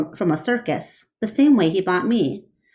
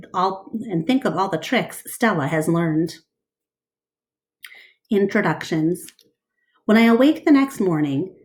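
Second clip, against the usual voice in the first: ordinary delivery, nothing marked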